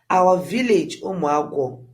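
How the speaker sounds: plain reading voice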